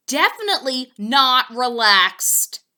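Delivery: loud emphatic cry; cried out. A narrator's reading. Poppleton crying out while swimming beside Fillmore, loud. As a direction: hit definitely hard in dismay. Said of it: "cried Poppleton".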